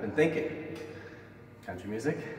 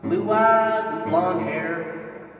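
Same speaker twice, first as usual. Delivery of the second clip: wrestling promo. Acted.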